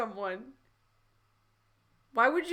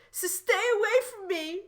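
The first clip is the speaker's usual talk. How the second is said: high-pitched panicked story shout; acted shout, pitch leaping up; 9 semitones higher than usual. A narrator's reading. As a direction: cry it out high, as a frightened character. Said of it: "Paper shouted".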